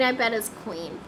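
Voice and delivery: normal talking voice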